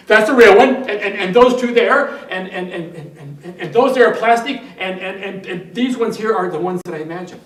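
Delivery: loud, high, stammering mock panic; acted desperate shouting; frantic patient. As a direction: blurt it out urgently, tripping on repeats. Panicked patient frantically sorting the snakes.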